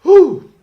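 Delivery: loud high whew to reset; real steadying breath; composing exhale. Real, not acted. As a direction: blow out the giggles. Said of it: "Let me try again".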